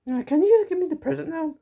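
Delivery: put-on high, fast, squeaky kid; a high excited little kid voice; child voice. Child character asks Santa.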